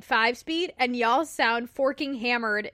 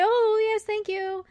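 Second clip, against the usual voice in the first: high, airy polite deflection; bashful brush-off; 10 semitones higher, slower than usual. Acted. Daughter acting out shying away from praise, high bashful brush-off. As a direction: wave the compliment away in a high voice. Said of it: "we shy away and we wave our hand and say".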